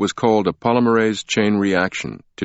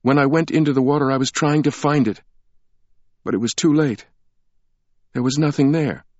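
Sienna's voice; 8 semitones higher than usual. A narrator's reading.

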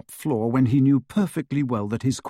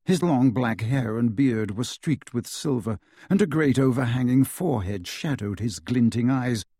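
ordinary delivery, nothing marked

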